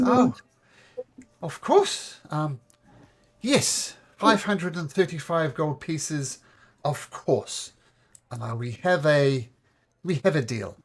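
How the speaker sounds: high, eager halfling voice; nervous halfling. Acted. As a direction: squeak out a hurried yes to the deal.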